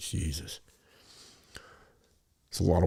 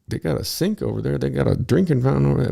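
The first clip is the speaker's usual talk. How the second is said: higher and louder, indignant protest; exasperated; 8 semitones higher, 6 dB louder than usual. Retold or imitated. Arguing with girlfriend about bottled water, loud indignant. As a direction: point out the free water, outraged. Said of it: "I'm like".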